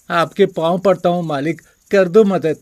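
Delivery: high, pleading servant's voice; a hint of desperate begging. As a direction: plead at his master's feet. Servant begs master for money.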